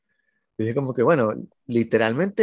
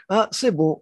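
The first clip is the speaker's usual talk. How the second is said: mock French dismissal; 7 semitones higher, much slower than usual. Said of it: "Como que".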